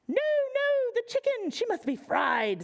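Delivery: high mock pirate cry; squeaky pirate. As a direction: play a fussy pirate objecting. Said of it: "said a gold-toothed pirate".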